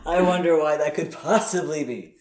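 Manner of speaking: dry retort through a laugh; mock innocence, cracking up; laughing sarcasm. Real, not acted. Cohost jabbing back at the host, laughing sarcastically.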